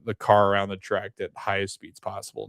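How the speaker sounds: usual speaking voice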